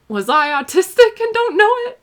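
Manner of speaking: high, loud surprised question. Real, not acted. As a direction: ask it in sudden disbelief. Sudden realization, own question.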